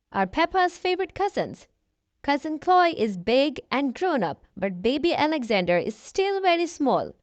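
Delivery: plain reading voice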